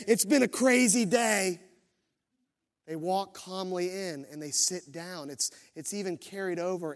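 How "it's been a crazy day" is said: louder, higher, hurried mock CEO; flustered CEO. CEO rushing into the boardroom, mock flustered. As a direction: play a frazzled executive, hammed-up. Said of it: "CEOs don't run to the boardroom and say".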